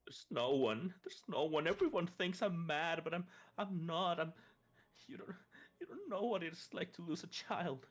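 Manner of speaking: pleading as a grieving mother; distraught pleading. Acted.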